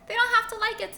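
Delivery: very high, loud, dismissive; bossy frog. Acted. Frog brushing off the dog's worry, high and loud. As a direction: snap it back, bossy.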